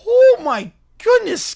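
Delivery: high, loud, stretched-out astonishment; amazed exclamation. Real, not acted. Streamer reacting to a twenty donation, amazed. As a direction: exclaim slowly in real amazement.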